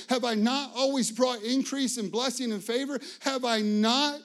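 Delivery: God's challenge, building. Retold or imitated. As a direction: press each question harder as God. God's rhetorical questions, building.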